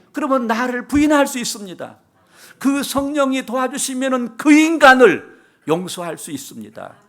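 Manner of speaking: loud, high preaching climax; fiery preaching. Acted. Preacher urging the congregation to rely on the Spirit: full-force preaching. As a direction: drive the call home to the church.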